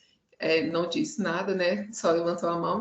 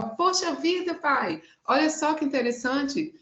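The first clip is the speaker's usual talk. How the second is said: put-on high, thrilled child's voice; lightly voiced boy, bursting with news; overjoyed little boy; 7 semitones higher than usual. Boy tells his father about the bigger sale, high excited voice. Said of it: "mais exultante, mais alegre ainda".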